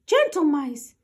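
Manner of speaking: high squeaky professor; professor mouse. Acted. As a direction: address the room like a tiny scholar. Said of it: "said Professor O'Hare".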